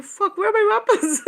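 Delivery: high startled yell just out of sleep. Retold or imitated. Just woken from a dream, yelling for lost weapons, high and alarmed. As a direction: blurt it out in alarm, high and loud. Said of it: "I wake up and I'm like".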